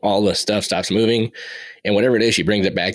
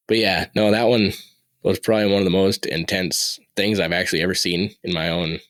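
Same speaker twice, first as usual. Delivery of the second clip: ordinary talk, nothing marked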